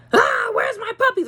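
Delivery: high-pitched panicky dog voice; mock freak-out. Owner voicing the dog missing her puppies, mock freak-out. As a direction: imitate a frantic mother in a squealing pitch. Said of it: "freak out like".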